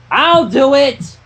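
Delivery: slow calling out across a room; shouted. Acted. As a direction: call it out to the back of the store. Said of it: "she shouted across the room".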